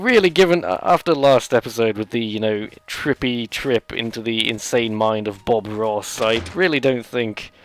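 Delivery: normal talking voice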